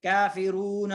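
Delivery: usual speaking voice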